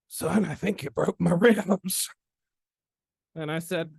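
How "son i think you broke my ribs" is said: high, slow, strained imitation of a winded man; winded South Carolina mentor. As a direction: squeeze it out in a mock Southern drawl. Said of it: "I knocked the wind out of him".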